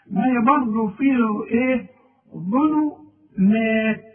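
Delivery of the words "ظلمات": ظلمات drawn out slowly, full final ت; clear ending. Shown as a demonstration. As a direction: stretch ظلمات slowly to its end. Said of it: "ما تعرفش تقول مات". (نطق ظلمات ببطء ووضوح)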